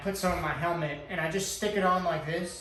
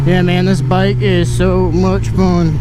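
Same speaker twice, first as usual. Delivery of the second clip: inside a helmet; 14 dB louder than usual. Real, not acted. Riding moto vlog in helmet mic.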